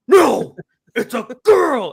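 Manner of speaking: put-on stubborn little girl shouting back; angry child. Father mimics his niece arguing with his son, loud and high. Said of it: "And she's like".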